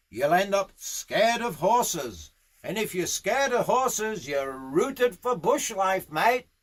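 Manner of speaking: high-pitched, emphatic bush horseman; gruffly encouraging, pitch swooping up; blunt Aussie riding boss. Acted. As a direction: push the lad to get back on. Boss pushes rider to remount.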